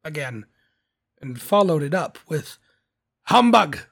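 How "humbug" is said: loud, high exclamation of scorn; scoffing Scrooge. A narrator's reading. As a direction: burst out dismissively.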